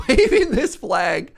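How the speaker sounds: cracking up mid-story; laughing. Real, not acted. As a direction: laugh through the words, drawn out. Laughing at the absurd scene.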